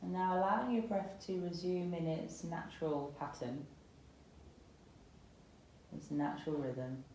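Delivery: calm, unhurried yoga meditation voice with long pauses; guided meditation. Acted. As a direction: gently guide them into settling and softening, phrase by phrase. Guides breathing and body scan.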